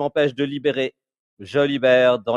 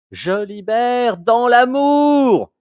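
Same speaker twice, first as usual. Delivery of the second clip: high, slow shouted chant; shouted mantra; 7 semitones higher, 4 dB louder, slower than usual. Shown as a demonstration. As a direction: cry the mantra out. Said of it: "Il faut que je le crie".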